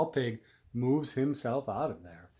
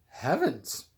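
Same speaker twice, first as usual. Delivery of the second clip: cries out; 3 semitones higher, 6 dB louder than usual. A narrator's reading. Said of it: "cries the farmer's wife".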